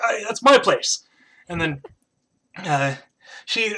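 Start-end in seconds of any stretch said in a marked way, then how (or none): 0.0-1.0 high, loud, indignant squirrel; Diedrich. Acted. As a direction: protest loudly as a jealous squirrel. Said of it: "then Diedrich's like".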